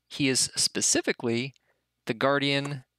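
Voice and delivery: usual speaking voice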